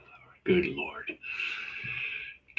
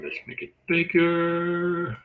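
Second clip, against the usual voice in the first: high, held, almost sung last word; high singsong drawl while fiddling with something; playful drawn-out aside; 10 semitones higher than usual. Real, not acted. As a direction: stretch it out slowly on a high pitch. Playful aside while enlarging the slide.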